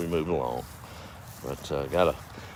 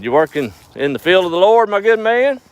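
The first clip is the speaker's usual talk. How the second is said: high hollering preacher; 12 semitones higher, 7 dB louder than usual. Acted. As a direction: call out like an eager preacher. Zealous preacher hollering at farmer.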